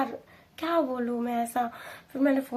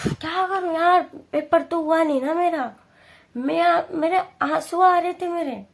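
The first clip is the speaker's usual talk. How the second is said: higher, tearful retelling; choked-up and high; upset; 5 semitones higher, 4 dB louder than usual. Retold or imitated. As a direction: close to tears over the paper. Crying after failed exam, retold.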